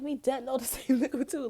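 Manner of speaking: high, loud, laughing through it; laughing retort. Retold or imitated. Retelling the clash, laughing high and loud. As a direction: crack up mid-sentence. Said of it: "And I'm like".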